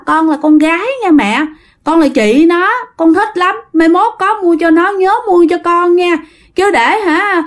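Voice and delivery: put-on high, gushing little-girl delight; delighted spirit child. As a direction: coo over the bracelet like a thrilled ghost girl. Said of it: "Nó nói".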